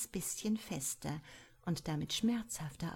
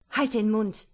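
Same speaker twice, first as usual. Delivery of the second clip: loud, higher shout to shut him up; shouted order; 4 semitones higher, 11 dB louder than usual. A narrator's reading. Cory yells at her secretary to shut up, loud shout. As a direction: yell him into silence. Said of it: "schrie sie ihn an".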